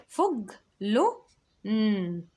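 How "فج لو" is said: فج لو raised and drawn out; high, slow syllables. Shown as a demonstration. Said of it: "تحس إن في نون". (فج لو بصوت عالي وببطء)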